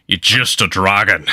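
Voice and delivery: Sean Connery dragon. Retold or imitated. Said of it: "It's like Sean Connery, Dragonheart dragon".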